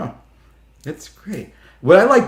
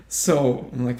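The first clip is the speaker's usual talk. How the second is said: ordinary talk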